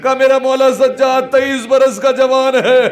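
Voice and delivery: mourning sermon lament. Acted. Preacher telling mourners of the caravan to Karbala, high wailing lament. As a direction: preach the tragedy at full, tearful pitch to stir weeping.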